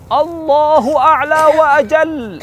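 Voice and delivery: high, loud, long-drawn cry; crowd shout. Acted. Army answering Abu Sufyan, drawn-out high shout. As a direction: shout it like a whole mountain answering. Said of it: "Satu gunung semua teriak".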